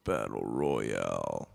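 low, slow "Battle royale" like a trailer announcer; deep announcer voice. Pretend. Deep dramatic echo of phrase.

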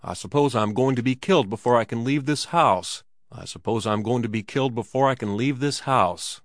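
ordinary delivery

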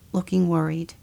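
ordinary talk, nothing marked

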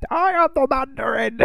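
high, hammed-up villain shout; Mandarin impression. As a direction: proclaim like a pantomime villain. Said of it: "The other one, Guy Pearce".

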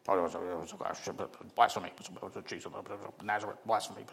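mock scribe grumbling blasphemy under his breath; grumbling to themselves. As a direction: grumble it darkly to yourself like a scandalised scribe. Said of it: "they grumbled to themselves".

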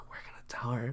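hushed, voiceless retold whisper. Older kids in the bush threaten to tell, whispering. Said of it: "they're whispering".

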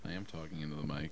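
far from the mic, faint; turned away from mic. Real, not acted. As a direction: still speaking off-mic. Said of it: "You have to, like, hold it or like this".

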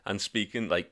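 ordinary talk

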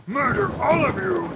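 dragon voice. Acted. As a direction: growl it out like a dragon. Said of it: "said the dragon".

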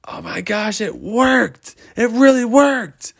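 high-pitched amazed squeal; thrilled exclamation. Acted. Girl seeing the snow wish came true, high thrilled voice. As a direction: squeal it in delighted disbelief. She sees the wish worked.

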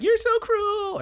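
very high, drawn-out squeal; mock cry. Girl calling after him as someone runs away, mock wail. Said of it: "she's like".

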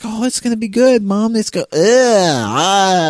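put-on high-pitched child turning to disgust; eager kid self. Kid telling Mom it's gonna be good, then disgusted. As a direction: play an excited kid who gags on the taste. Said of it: "when I was a kid".

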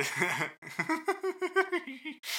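cackling hyena-like giggle; gnoll laugh. Acted. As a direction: hyena giggle, high and wild. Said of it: "was that your gnoll laugh?".

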